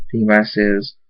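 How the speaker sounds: ordinary delivery, nothing marked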